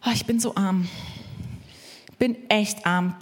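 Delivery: lightly voiced poor-me moan; self-pitying victim. Preacher plays the victim in the triangle, self-pitying. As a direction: play the sorry victim. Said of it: "Dann gibt's ein Opfer".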